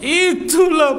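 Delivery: high-pitched mock widow's wail; hammed-up grieving wail. Widow answering the next group of mourners, hammed-up wail. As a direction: wail it as if fainting from grief. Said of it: "Ah, pingsan lah dia balik".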